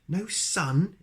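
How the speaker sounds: high, loud astonished cry; exclaimed. A narrator's reading. The wizard hearing Coldland has no sun, exclaiming high and loud. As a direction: gasp it out in amazement. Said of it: "exclaimed the wizard".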